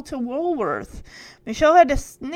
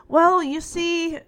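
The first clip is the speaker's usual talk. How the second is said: higher, slow stalling start; hesitant explaining; 6 semitones higher, slower than usual. A narrator's reading. Michelle tries to explain to Robin, hesitant. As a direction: stretch it out while groping for an explanation. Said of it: "Michelle tried to explain".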